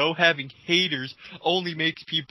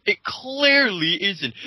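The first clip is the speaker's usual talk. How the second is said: slow mock; 3 semitones higher, 5 dB louder, much slower than usual. Mocking the troll's claim.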